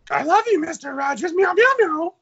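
loud, very high falsetto puppet cat. Acted. Cat puppet addressing Mister Rogers, high loud cat voice. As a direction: squeal it adoringly as a puppet cat. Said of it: "do my cat voice for you".

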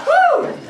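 high, blasting "woo"; loud whoop. Acted. Cheering his cheap restaurant plan, loud whoop. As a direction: whoop at full voice. Whoop after McDonald's punchline.

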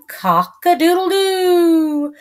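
high, loud rooster crowing. Acted. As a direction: crow it out from the barn top. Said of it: "He crowed".

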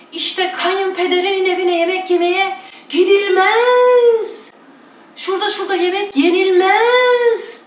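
high, loud, slow preachy mockery; mock preacher. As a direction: lecture like a self-righteous preacher. Mocking an Instagram preacher.

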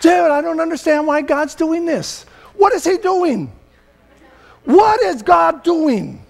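lightly voiced whining believer, slow on the last question; mock complainer. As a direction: imitate a baffled complainer blaming God. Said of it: "When I hear people say that all the time".

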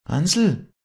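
high, loud call. A narrator's reading. Walli calling to her vulture in passing, high loud call. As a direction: sing out the name, pitched well up. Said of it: "rief sie im Vorbeigehen dem Geier zu".

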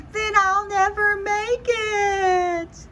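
high, slow, tiny wail of giving up; a small butterfly sinking into hopelessness; despairing butterfly. Acted. Butterfly to whale, sure she'll never make it, high slow despair.

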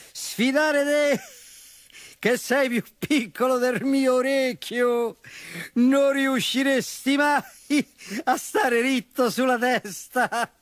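high, loud mocking bull voice; scornful bull. A narrator's reading. Bull scorning the mouse, loud mocking voice. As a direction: sneer at the tiny mouse.

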